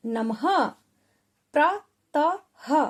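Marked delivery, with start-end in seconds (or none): 1.5-2.8 प्रतः drawn out very slowly; stretched sounding out. Shown as a demonstration. Teacher slowly sounding out the word प्रातः for children. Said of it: "प्रातः।".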